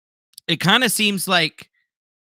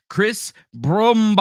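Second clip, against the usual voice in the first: slow, hammed-up name announcement; drawn-out shout-out; 6 semitones higher, 3 dB louder, slower than usual. Real, not acted. Streamer greeting a viewer in chat, drawn-out shout-out. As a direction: stretch the name out, rising high.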